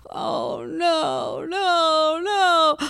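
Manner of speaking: high, drawn-out moaning cry; imitated panicked wail.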